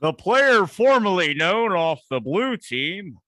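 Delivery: big, drawn-out announcer voice, loud; mock announcer. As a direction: boom it out slowly like a stadium announcer. Mock announcer quip on sponsored runner.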